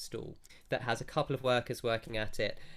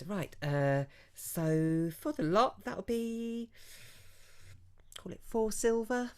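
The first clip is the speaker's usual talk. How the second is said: higher, slow showy trader voice; shopkeeper naming a price; 9 semitones higher, slower than usual. Acted. Branderi quotes the price, high and drawn out. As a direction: draw out the price like a theatrical merchant. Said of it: "puts it down and goes".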